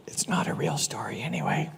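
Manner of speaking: a conspiratorial whisper to the audience; hushed throwaway confession; whispered aside. Real, not acted. Aside to audience mid-story.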